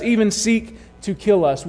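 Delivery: normal talking voice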